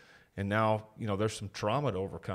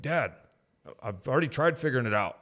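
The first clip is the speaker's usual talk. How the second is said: higher, louder protest, imitating a son; mock defensive kid pushing back at Dad; 5 semitones higher, 5 dB louder than usual. Son answering his dad before being blamed, defensive. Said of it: "he would get defensive".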